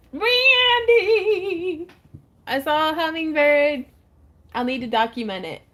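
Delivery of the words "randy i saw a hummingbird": high, loud, excited calling out; excited scream. Real, not acted. [name] calls Randy about a hummingbird, excited high yell. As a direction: call out loudly with thrilled news. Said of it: "Oh, you really screamed right into the mic".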